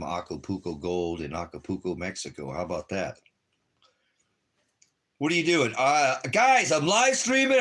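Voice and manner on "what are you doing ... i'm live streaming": much higher, louder, hyped vlogger voice; mock party host on camera; excited livestreamer. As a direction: play an overexcited streamer greeting fans. Pretends to livestream from Mexico.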